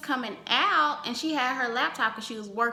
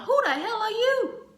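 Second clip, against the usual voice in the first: indignant; 11 semitones higher, 4 dB louder than usual. Retold or imitated. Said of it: "I'm just like".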